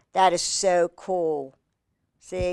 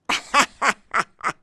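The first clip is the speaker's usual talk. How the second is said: short burst of laughter; 3 semitones higher than usual. Real, not acted. Reader amused at the squirrel book, laughing out. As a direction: break into a quick, pulsing laugh.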